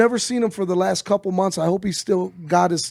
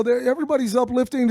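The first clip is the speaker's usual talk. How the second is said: ordinary delivery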